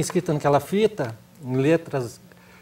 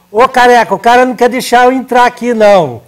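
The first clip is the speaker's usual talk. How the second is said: loud call across the room, pitch high; 7 semitones higher, 12 dB louder than usual. Retold or imitated. Shouting to Careca past the guard, loud and high. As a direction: shout for Careca to come help.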